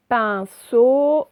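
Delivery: "Pinceau" loud, drawn out in two syllables; syllable demonstration. Shown as a demonstration. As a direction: stretch "Pinceau" so each syllable is heard. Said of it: "c'est dans la première".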